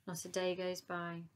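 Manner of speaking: usual speaking voice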